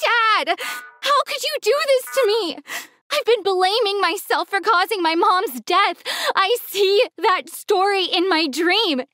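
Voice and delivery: anguished high-pitched outcry; upset yelling. Acted. Daughter confronts her dad over the lie, upset yelling. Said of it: "I yelled".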